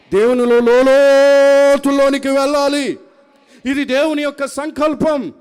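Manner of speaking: loud, high declarations with drawn-out peaks; full-force preaching. Real, not acted. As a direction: proclaim it loudly to the congregation. (గట్టిగా, ఆవేశంగా ప్రసంగం)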